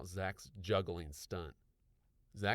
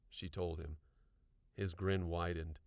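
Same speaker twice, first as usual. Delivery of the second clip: ordinary delivery, nothing marked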